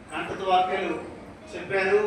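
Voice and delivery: ordinary talk, nothing marked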